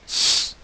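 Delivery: S as a long hiss, no vowel; S held as pure sibilant, not the letter name; isolated S sound. Shown as a demonstration. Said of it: "la syllabe où l'on entend le son".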